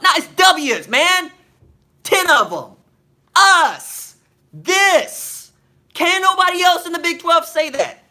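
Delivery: loud, high-pitched, heated rant; emphatic boast. Real, not acted. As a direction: punch each phrase out loud and high. Heated boast about the team's wins.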